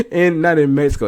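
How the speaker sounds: loud, high, worked-up correction; excited correction. Real, not acted. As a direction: still fired up, fix the slip. Correcting himself, still worked up.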